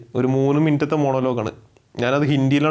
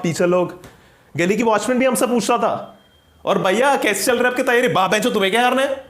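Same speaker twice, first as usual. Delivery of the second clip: exasperated student telling off an interviewer, higher than own chat; ranting student candidate; 6 semitones higher, slower than usual. Acted. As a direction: pour out a pent-up grievance at the interviewer. Candidate rants in monologue. (भड़का हुआ छात्र, शिकायत भरे लहजे में)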